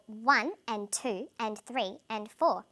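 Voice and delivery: slow even beat on ONE, TWO, THREE, FOUR with a light "and" between; measured rhythmic count, "and" unstressed; stress on numbers. Shown as a demonstration. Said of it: "the words I'm stressing are the numbers".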